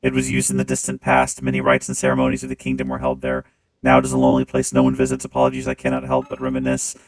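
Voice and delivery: deep, loud clanging machine voice; clangy robot voice. Acted. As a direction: speak through a booming robot filter. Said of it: "when I turn on the robot voice".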